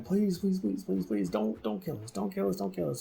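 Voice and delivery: hammed-up begging for mercy. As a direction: tumble out repeated pleas, stammering a little. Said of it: "they start begging again".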